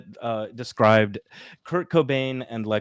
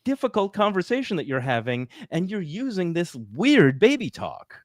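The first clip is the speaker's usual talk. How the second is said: high-pitched exasperated scolding; mock incredulous rebuke; 8 semitones higher than usual. Acted incredulous reply to euphemism users.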